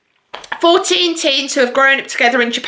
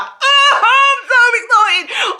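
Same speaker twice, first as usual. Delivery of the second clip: much higher, squealed and stretched out; squealing excitement; 13 semitones higher, much slower than usual. Retold or imitated. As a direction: replay the moment you got the proof, squealing. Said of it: "and I was like".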